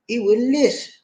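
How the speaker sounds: usual speaking voice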